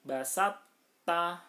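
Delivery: "Basat ta" recited loud with a held doubled t; "Basat ta" as tajwid, t held then opened; merged to into ta. Shown as a demonstration. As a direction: chant "Basat ta" with no separate heavy t released. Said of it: "toknya dihilangkan, taknya ditasdidkan".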